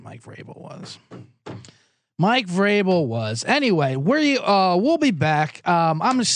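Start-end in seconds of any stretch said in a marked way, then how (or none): 0.0-1.0 whispered aside. Acted. Host answering himself in a hushed whisper. Answers his own question twice. 2.2-3.5 slow, drawn-out declaration; slow emphatic. Acted. Host naming the culprit, slow and emphatic. Answers his own question twice.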